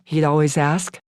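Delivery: usual speaking voice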